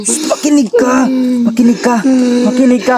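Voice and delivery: high-pitched, insistent pleading; urgent pleading. Acted. Man begs a struggling captive to stop hitting and listen, urgent pleading.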